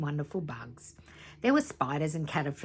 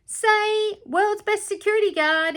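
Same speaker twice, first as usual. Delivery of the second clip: much higher, loud hammed-up voice; cheery photo call; 8 dB louder than usual. A narrator's reading. Guard getting them to pose, high and loud.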